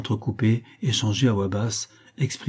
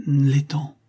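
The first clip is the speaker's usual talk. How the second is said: plain reading voice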